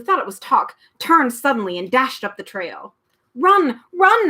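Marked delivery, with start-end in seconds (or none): 3.3-4.3 shouted. A narrator's reading. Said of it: "it shouted again".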